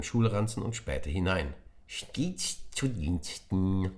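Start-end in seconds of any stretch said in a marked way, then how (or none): 1.9-3.9 very slow eager creature voice; magic creature at your service; little creature calling. Acted. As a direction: greet him as a cheerful servant. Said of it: "rief es ihm entgegen".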